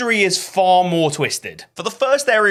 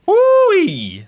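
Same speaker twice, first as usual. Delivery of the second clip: much higher, louder excited woo-wee; delighted whoop; 8 dB louder than usual. Real, not acted. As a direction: whoop with glee at the hot pot.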